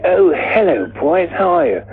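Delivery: high-pitched, awfully British greeting; awfully British accent. Retold or imitated. Weird-looking mate pops his head round the door, awfully British accent. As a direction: greet them in an exaggerated British voice. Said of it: "awfully British accent".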